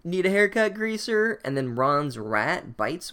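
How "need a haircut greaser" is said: bully taunt. Retold or imitated. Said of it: "the evil kids are like".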